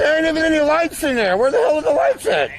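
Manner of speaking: high loud scared complaint; scared horror game streamer. Real, not acted. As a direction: shout it nervously. Scared at no lights.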